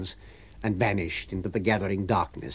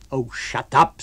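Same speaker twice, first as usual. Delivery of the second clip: mildly raised, vigorous rebuke; sudden vigour; 3 semitones higher, 5 dB louder, slower than usual. A narrator's reading. Unseen master silencing his whining tramp, vigorous. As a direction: cut in with a louder, irritated order. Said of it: "with sudden amazing vigour".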